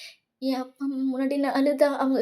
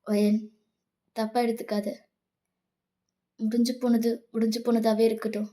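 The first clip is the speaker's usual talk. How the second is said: put-on forgiving boyfriend; 4 semitones lower than usual. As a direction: drop low and let the anger go. Voices Jimman forgiving her.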